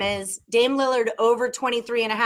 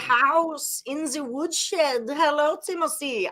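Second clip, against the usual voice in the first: slow, higher, hammy Russian-ish host voice; mock Russian accent; 5 semitones higher, slower than usual. Host introducing guest Timothy in a hammed-up Russian accent. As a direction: announce the guest in a bad Russian accent, slowly. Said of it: "I don't know why I'm using this voice".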